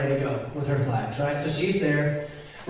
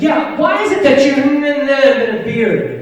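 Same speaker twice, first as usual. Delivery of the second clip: put-on loud, high, slow kid voice with the middle mumbled; kid's garbled question; 9 semitones higher, 9 dB louder, slower than usual. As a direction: blurt it like a kid, words mashed together. Imitates kid's unintelligible shouted question.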